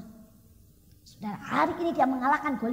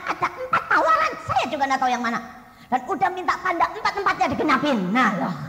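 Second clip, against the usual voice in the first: loud, higher, fast preaching; preaching at full force; 4 semitones higher, 7 dB louder than usual. Acted. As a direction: rouse the congregation with mock complaints.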